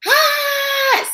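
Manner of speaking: high sharp shriek of surprise; startled scream. Retold or imitated. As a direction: jump out of your skin. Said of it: "And I was just like".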